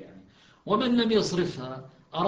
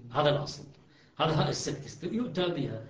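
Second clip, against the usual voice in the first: usual speaking voice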